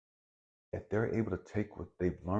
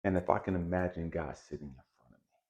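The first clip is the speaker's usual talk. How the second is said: ordinary talk, nothing marked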